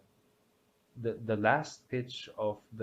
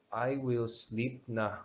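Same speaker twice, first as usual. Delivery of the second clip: ordinary delivery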